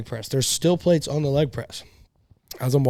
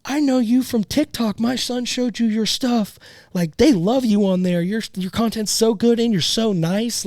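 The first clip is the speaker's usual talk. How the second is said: much higher, slow sweet lady voice; the lady fan; 9 semitones higher, slower than usual. Retold or imitated. Lady fan praising him, high sweet voice. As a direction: gush like a kind fan mom. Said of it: "she goes".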